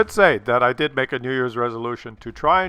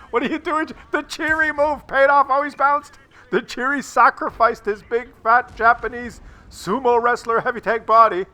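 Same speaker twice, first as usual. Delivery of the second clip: high, loud gleeful play-by-play; thrilled at the tank's gamble; excited commentary; 7 semitones higher, 5 dB louder than usual. Real, not acted. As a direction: cheer the tank on like a sportscaster. Excited commentary, tank survives.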